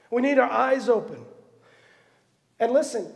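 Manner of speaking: ordinary talk, nothing marked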